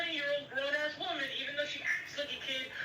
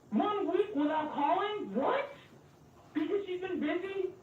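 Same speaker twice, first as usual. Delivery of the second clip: retold incredulous challenge; 7 semitones higher than usual. Speaker retells confronting a friend over a week without calling, incredulous. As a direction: challenge them in disbelief, voice lifting high on "What".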